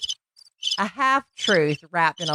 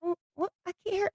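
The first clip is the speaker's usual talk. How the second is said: soft high mock puzzlement; 11 semitones higher, 9 dB quieter, faster than usual. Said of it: "I'm like".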